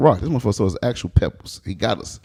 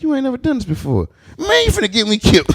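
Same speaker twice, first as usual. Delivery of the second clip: much higher, exasperated complaint; 12 semitones higher than usual. Retold or imitated. Mid-robbery, scolding a frozen partner at high pitch. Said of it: "I'm like".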